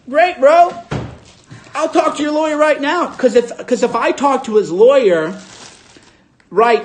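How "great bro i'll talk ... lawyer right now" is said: mock triumphant comeback. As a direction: throw it back at him, hammed up. Retold comeback to the venue owner.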